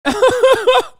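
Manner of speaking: loud, high burst of laughter. Real, not acted. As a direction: cackle out loud. Laughs at chatter.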